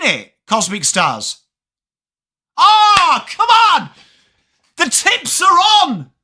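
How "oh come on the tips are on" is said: high, loud, thrilled cry as the game starts; excited shout. Real, not acted. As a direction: yell it out with real excitement. Live match reaction, game kicking off.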